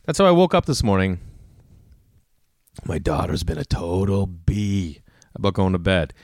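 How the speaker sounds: ordinary talk, nothing marked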